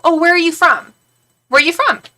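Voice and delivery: high, loud, keen inquiry; eager question. Retold or imitated. Greeting anyone with a British accent, eager questioning. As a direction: act out pouncing on a fellow Brit.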